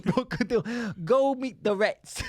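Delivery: put-on thick American accent. Boss sending men to the rat king, thick American accent. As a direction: send them off in broad New York. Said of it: "we just did our thick best American accents".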